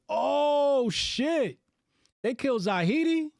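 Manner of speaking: high, loud, drawn-out gasp of shock; real stunned outburst at the headline; shocked disbelief. Real, not acted. Shocked by breaking news.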